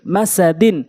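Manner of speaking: short vowels, syllables run together; quick reading. Shown as a demonstration. Teacher showing how it must be read, quickly. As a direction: read it briskly as one word. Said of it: "দ্রুতটা উচ্চারণ করতে হবে".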